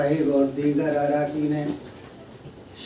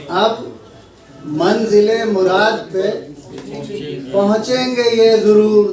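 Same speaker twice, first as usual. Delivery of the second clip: measured mushaira delivery, lines repeated with more lift; Urdu ghazal recitation; 3 semitones higher, 6 dB louder than usual. Acted. Poet reciting a ghazal to an appreciative gathering, declaimed. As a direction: recite each phrase with weight, pausing for praise.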